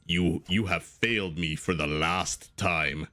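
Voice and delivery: deep, slow Darth Vader menace; James Earl Jones. Retold or imitated. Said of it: "good James Earl Jones impression?".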